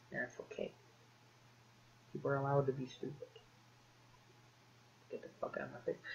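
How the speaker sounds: very soft, sinking to a whisper; hushed mock dismissal. Gamer dismisses defenders of Metroid Other M, hushed mock forgiveness. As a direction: murmur it, then whisper the final dismissal.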